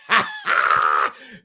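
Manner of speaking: high, loud cackle; shrieking laugh. Real, not acted. Laughing at Maddie's slay line with a loud shriek. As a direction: explode into laughter.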